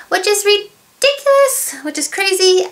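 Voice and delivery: high-pitched, drawn-out disbelief; incredulous exclamation. Real, not acted. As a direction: exclaim at an unbelievable deal, pitch way up. Exclaiming over a big price cut.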